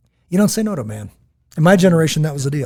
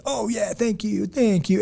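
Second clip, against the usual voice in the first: high, drawn-out sarcastic thanks; 8 semitones higher, slower than usual. Acted. Showing how sarcasm differs from meaning it, sarcastic. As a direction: lay on the mock gratitude, slow and high. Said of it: "and then sarcasm comes".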